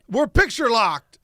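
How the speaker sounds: slow, high mock proclamation; loud announcement. Actor answering the puzzled crew, slow loud announcement. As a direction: declare it grandly, drawn out and loud. Retelling his on-set announcement.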